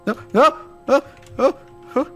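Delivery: high, loud, short cries of alarm; panicked yelps. Acted. Reluctant jumper dropping into a hole, panicked. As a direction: yelp on the way down.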